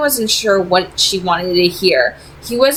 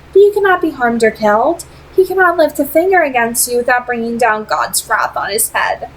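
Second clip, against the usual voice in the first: put-on higher, poised vampire lady with a faint accent; Camille; 7 semitones higher than usual. A narrator's reading. Vampire leader tempting Simon with an offer, poised and higher. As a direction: speak as a cool, persuasive vampire leader.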